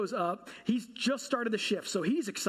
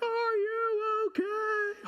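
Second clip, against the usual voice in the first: very high, slow, drawn-out concerned voice; worried wife; 13 semitones higher, much slower than usual. Retold or imitated. Wife running back to him after the crash, high drawn-out worry. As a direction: stretch it out like a frantic wife.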